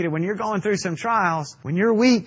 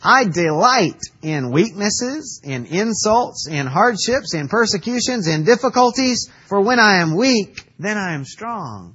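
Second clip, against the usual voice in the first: preached scripture reading; 5 semitones higher, 6 dB louder than usual. Acted. Preacher reads Paul's words aloud to the church, ringing and emphatic. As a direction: proclaim the verse to the congregation.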